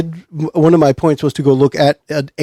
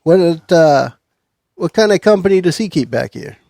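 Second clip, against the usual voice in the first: higher, louder detective; friendly investigator prying; 4 semitones higher, 5 dB louder than usual. Acted. Player's detective questions the woman.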